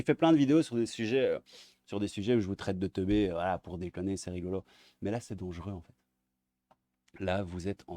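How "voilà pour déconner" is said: ordinary talk, nothing marked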